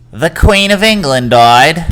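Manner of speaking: hammed-up British accent, loud and high; slow, loud attempt at a British accent. Shown as a demonstration. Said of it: "That's my British accent".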